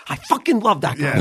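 "fucking" hit with a hard, leaned-on F. Retold or imitated. Showing a friend how he pronounced it, hard F. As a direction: imitate a friend by punching the F in "fucking". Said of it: "you pronounced fuck".

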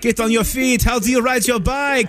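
a hint of an Italian hype man; lightly voiced Italian MC; Italian accent. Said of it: "Is that your Italian accent?".

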